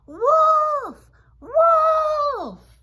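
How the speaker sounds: high, drawn-out full-voiced yell; storybook shepherd boy shouting the alarm, loud and high; yelling as loud as possible. A narrator's reading. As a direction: cry wolf at the top of your lungs. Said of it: "as loud as he could".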